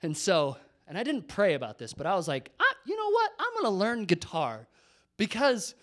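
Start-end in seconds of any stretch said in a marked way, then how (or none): 2.6-5.8 high, loud burst of sudden resolve; hammed-up eureka announcement; mock bold resolve. Retold bold impulsive decision.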